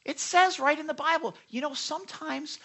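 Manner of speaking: high-pitched exasperated insistence. Real, not acted. Preacher fed up with Bible critics, exasperated. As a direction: protest as if it's maddeningly obvious. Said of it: "it drives me bananas".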